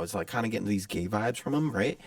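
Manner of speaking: usual speaking voice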